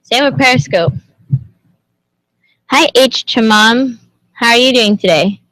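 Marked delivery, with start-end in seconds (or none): none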